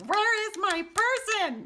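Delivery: high, loud, slow demand; mock screaming message. Reader voicing a viewer fed up waiting for their person, screaming. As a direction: scream each word out, stretched and high. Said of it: "one of those Harry Potter screaming messages".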